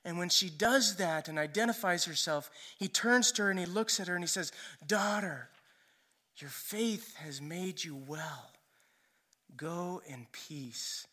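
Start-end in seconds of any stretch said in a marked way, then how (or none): 4.9-11.1 soft, slow and tender; slowing and hushing into a gentle blessing; loving, intimate. Retold or imitated. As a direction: speak it softly, intimately, with space between phrases. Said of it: "this very loving and intimate interaction".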